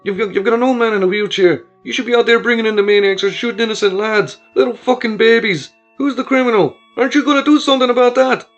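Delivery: grieving father raging at the guards; raging cry. Acted. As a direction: rage against the guards, rising. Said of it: "her husband's cry".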